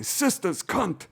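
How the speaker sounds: high, slow mock cursing; Uncle Junior. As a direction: imitate an exasperated mobster uncle, drawn out. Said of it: "Uncle Junior on The Sopranos".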